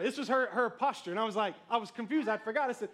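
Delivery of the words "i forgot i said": ordinary delivery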